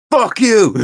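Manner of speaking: yelled high and loud as Jack; mock rage, voice jumping way up; furious shout. Husband yelling at his wife, furious shout.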